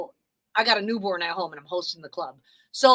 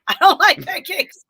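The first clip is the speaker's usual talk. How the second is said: giggling through the words, higher and louder; breaking up laughing while saying no; laughing refusal; 7 semitones higher, 9 dB louder, much slower than usual. Real, not acted. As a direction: laugh it out as you turn him down. Laughing refusal of pancake invite.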